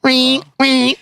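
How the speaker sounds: high squealing pig call. Pretend. Joking pig noise word.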